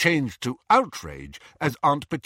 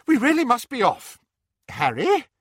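high, loud character voice; eager wizard; 6 dB louder than usual. A narrator's reading. Diggle telling Harry it is time to go, high and urgent. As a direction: announce the departure in a high, excitable voice.

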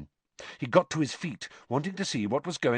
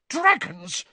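high, disgusted exclamation; 4 dB louder than usual. A narrator's reading. Nurse scolding about dragons, disgusted. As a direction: spit it out in disgust. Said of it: "in a disgusted tone".

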